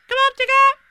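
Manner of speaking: high, loud little cry; excited cry. Acted. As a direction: call out like an eager little kangaroo. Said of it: "cried Roo".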